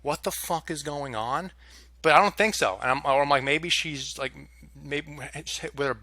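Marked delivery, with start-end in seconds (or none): none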